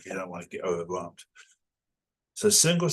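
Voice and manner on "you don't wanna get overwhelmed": much softer repeat, slightly lower; soft aside. Real, not acted. Teacher repeating advice to learners as a soft aside. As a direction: drop the volume for a quiet reminder.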